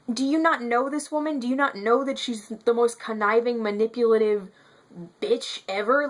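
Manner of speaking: getting worked up over his choice; exasperated outburst, pitch climbing; real anger. Real, not acted. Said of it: "Sorry for that little anger moment".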